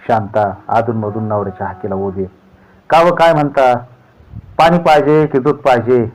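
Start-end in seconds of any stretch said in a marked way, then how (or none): 2.9-6.0 higher, louder wife's voice calling back; wife answering. A narrator's reading. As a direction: answer him from inside, louder. Wife answers husband's call. (नवऱ्याच्या हाकेला उत्तर देणारी बायको, उंच आवाजात)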